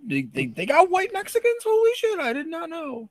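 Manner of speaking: very high, squeaky disbelief; hammed-up shock in a high voice; mock astonishment.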